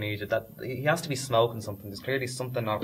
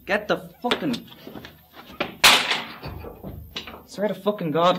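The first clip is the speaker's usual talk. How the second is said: high, raised swearing; exasperated outburst; 6 semitones higher, 4 dB louder than usual. Acted. Fed up with the whispering crew, exasperated high outburst. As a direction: lose patience mid-sentence.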